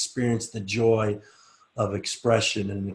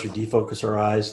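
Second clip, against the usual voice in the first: usual speaking voice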